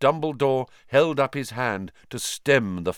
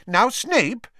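startled; 14 semitones higher, 5 dB louder than usual. Acted.

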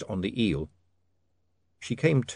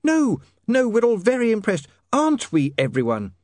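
high, loud forced praise; desperate enthusiasm; 12 semitones higher, 5 dB louder than usual. A narrator's reading. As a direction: cheer him up too eagerly, in a high woman's voice. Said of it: "with desperate enthusiasm".

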